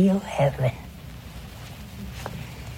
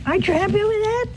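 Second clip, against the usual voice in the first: much higher, fast rising question; mock innocent question; 12 semitones higher, much faster than usual. Priest needling the widow, high fast teasing. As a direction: squeak it out, faux-naive. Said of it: "I said".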